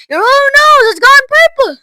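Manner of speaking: very high, loud outburst; alarmed shout. A narrator's reading. Father to Violet about her nose, shouting in alarm. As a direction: shout in disbelief and horror. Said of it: "shouted Mister Beauregard".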